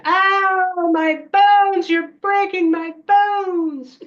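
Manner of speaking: high, loud wail of mock agony; hammed-up pained scream. Child faking pain as the witch pulls, theatrical screaming. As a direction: scream it out, stretching the words. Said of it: "and I screamed".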